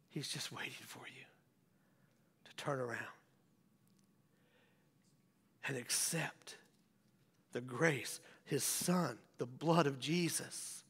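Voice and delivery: hushed, low whisper. Real, not acted. Pastor whispering an invitation to turn back. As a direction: draw them in with a whisper.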